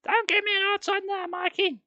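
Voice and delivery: very high scolding mother; high sharp mum voice. Acted. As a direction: scold the fussing baby. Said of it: "Mum said sharply".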